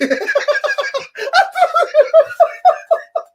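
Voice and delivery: high-pitched burst of laughter; loud high laugh. Real, not acted. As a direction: crack up loudly. Laughing at own joke.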